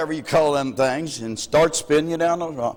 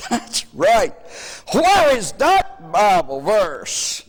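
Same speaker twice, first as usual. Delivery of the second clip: higher taunting voice; mocking man; 6 semitones higher, 3 dB louder, slower than usual. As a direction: taunt with a raised voice.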